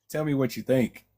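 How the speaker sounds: ordinary delivery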